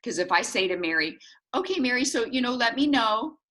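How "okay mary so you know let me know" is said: higher-pitched breezy brush-off; light, noncommittal, higher voice; casual non-ask. Acted. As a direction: toss it out as a non-committal sign-off.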